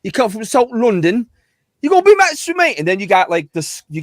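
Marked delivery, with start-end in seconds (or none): 0.0-2.8 loud, high-pitched Cockney put-on; Cockney accent. Shown as a demonstration. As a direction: throw on a brash South London Cockney accent. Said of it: "bit Cockney accent there".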